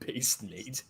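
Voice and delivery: whispered through a laugh; breathy giggling aside. Real, not acted.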